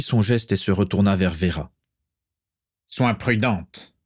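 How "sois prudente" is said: slow, measured cold caution; understated icy warning. A narrator's reading. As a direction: warn coldly, taking your time. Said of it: "dit-il avec froideur".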